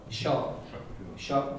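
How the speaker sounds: Shaw said short and soft; short vowel. Shown as a demonstration. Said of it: "the second one is short".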